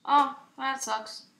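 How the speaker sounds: normal talking voice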